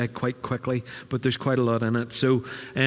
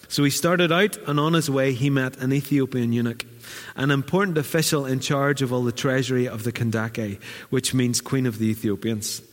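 normal talking voice

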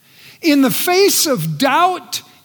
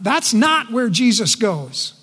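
normal talking voice

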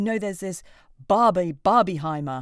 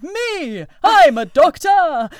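loud, slow, much higher proud announcement; hammed-up hero stepping forward; heroic volunteer; 9 semitones higher, 12 dB louder, much slower than usual. Man leaping up on the train to volunteer, loud proud declaration. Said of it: "some guy leapt up and said".

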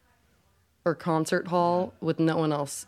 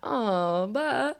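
reverb voice; 5 semitones higher than usual. Pretend. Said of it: "It's getting worse".

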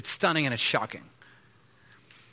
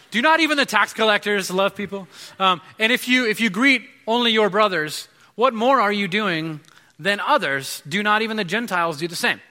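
scripture reading; 5 semitones higher, 4 dB louder than usual. Acted. Pastor reading the Bible to the congregation, preaching loudly. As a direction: proclaim the verses to the room.

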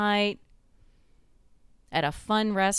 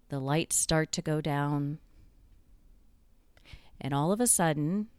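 ordinary talk

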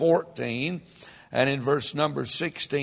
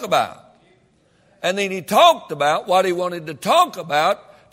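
louder, rising preacher's cadence, punching phrases; preaching at full force; 4 semitones higher, 6 dB louder than usual. Real, not acted. Preacher stirring up the congregation, fiery and loud.

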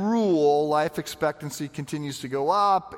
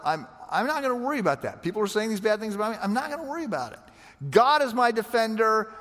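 much higher, animated, carefree voice; a cheerful shrug of a voice, pitched well up; retold Edwards quote; 6 semitones higher than usual. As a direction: play the unbothered pastor, airy and high. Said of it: "He just says".

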